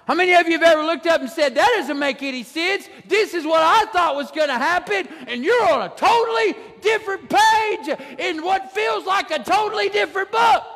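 climbing pitch, shouted toward the end; preaching at full force. Acted. Pastor preaching to the congregation, rising to a shout. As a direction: build it up like a revival preacher.

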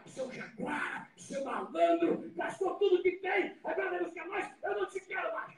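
high-pitched mock telling-off; acted scolding. Imagined father scolding his prodigal son, acted reproach. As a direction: reel off the rebukes high, as a put-on scolding.

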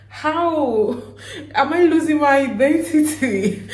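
high, loud, laughing disbelief; mock dismay. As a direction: cry out in playful alarm, half laughing. Acted shocked reply to teasing friends.

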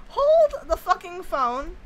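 slow, loud emphatic disbelief; exasperated. Real, not acted. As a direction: stop everything, this is absurd. Exasperated at game glitch.

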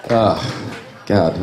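ordinary delivery

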